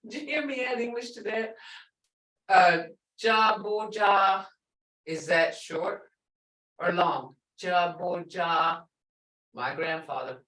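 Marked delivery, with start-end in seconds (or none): 3.2-4.5 "ja boja" slowly, with the "ah" vowels held; held longer. Shown as a demonstration. Teacher saying my grandfather for students, long vowels. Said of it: "held longer". 7.6-8.8 "Jaboja" slow, with long "ah" sounds; held longer. Shown as a demonstration. Teacher quizzing students short or long, held vowels. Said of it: "held longer".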